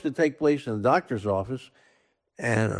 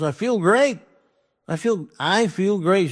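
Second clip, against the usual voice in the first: cheerful insisting; 7 semitones higher than usual. Retold or imitated. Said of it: "He goes".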